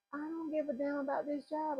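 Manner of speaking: high-pitched, defiant friend quote; defiant attitude. Retold or imitated. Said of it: "her energy and attitude".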